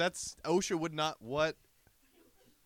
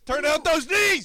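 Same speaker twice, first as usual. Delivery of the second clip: barking a drill order, much higher and louder; coach yell; 10 semitones higher, 11 dB louder than usual. Acted. Coaching jumping jacks, yelling.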